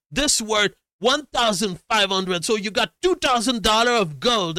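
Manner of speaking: high, loud, insistent sales plea; hammed-up frantic haggler imitation; pushy hustler. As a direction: push the deal loudly in a high voice. Imitates man hawking gold for cash.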